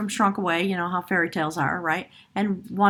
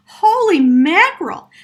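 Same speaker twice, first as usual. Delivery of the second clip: high, loud and slow amazement; astonished exclamation; 9 semitones higher, 11 dB louder, slower than usual. Real, not acted. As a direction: burst out in real astonishment. Reader astonished by the cards.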